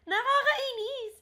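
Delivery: high laughing squeal of kilig; giddy laughing complaint. Real, not acted. Host squeals at sweet story.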